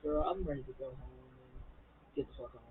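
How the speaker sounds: like she's done with the night; tired, over-it mutter; fed up. Retold or imitated. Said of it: "she's like".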